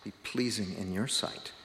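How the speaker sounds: soft, hushed closing prayer. Real, not acted. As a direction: pray softly, letting the phrases settle. Opening prayer before the sermon.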